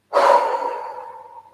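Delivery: a long, breathy sigh out; heavy sigh. Real, not acted. Overcome while reading cards to viewers, long sigh. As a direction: let the feeling out in one long sigh.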